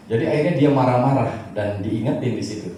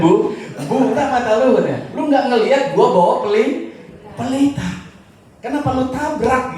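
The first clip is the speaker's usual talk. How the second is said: mock angry yell; 8 semitones higher than usual. Blind man yelling at the one who bumped him, hammed-up angry. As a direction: snap at him in a hammed-up rage. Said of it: "Jadi dia marah sama orang itu".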